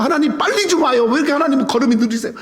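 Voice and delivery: hammed-up impatience, calling ahead; preacher imitating his younger self rushing God; impatient mock pleading. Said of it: "막 이러면서".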